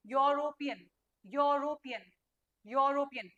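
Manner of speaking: "यूरोपियन" as "yaw-ro-pi-yan"; "यूरोपियन" with an open o in the first syllable; correct pronunciation demo. Shown as a demonstration. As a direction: model "यूरोपियन" deliberately, starting "yo". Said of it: "इसको क्या बोलेंगे".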